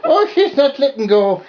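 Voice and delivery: high, cooing puppy voice; high baby talk. Acted. Puppy chewing her finger.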